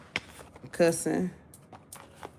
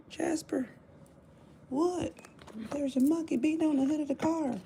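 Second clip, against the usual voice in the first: high quoting voice, calling Jasper; high excited skit quote; Chappelle Show skit; 11 semitones higher than usual. Retold or imitated. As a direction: replay the monkey skit line calling out. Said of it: "Dave Chappelle's show".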